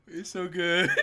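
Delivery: crying with laughter. Real, not acted.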